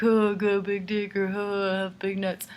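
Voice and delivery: mock goofy Indiana guy boasting; lightly voiced joker bragging; joking brag. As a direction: put on a silly voice for a crude joke. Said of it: "Like, it's a joke. It's funny".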